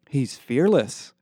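plain reading voice

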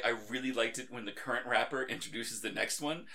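usual speaking voice

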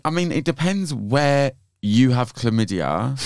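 ordinary delivery

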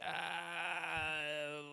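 long drawn-out mock wail of a no; imitated drawn-out balking; reluctant refusal. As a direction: stretch it out slowly, hammed-up reluctance. Voicing her reluctant refusal.

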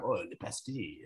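low, soft mock French accent. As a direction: mutter it as a put-on Frenchman. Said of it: "it's probably like".

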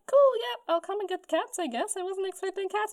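high, airy imitation; casual acted acceptance. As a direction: voice her easygoing reply up high. Voicing Rosa answering the station.

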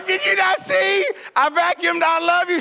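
high, loud comic protest; exasperated shouting. Retold or imitated. Husband protests to wife about vacuum tracks, exasperated shouting. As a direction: yell it high, in disbelief.

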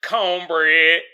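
mocking exaggerated Southern drawl on "Cornbread"; imitated put-on Southern accent; mimicked cornbread accent.

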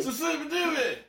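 high, drawn-out soul squeal; slow, very high soul cry. Acted. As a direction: stretch it into a funk-singer yelp. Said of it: "You do sound like [name]".

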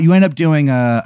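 ordinary talk

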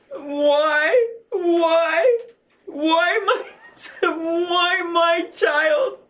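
tearful wail, held short of screaming; retold anguished scream. Mother cries out over her dead son, retold anguished scream. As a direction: relive the cry of grief, drawing out the last words. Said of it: "fell to the ground and screamed".

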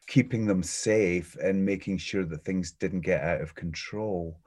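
usual speaking voice

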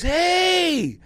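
a high, bright call across the fair; a mock shout of hey; called-out greeting. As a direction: hail someone you recognise, pitched high. Stranger calls out to him.

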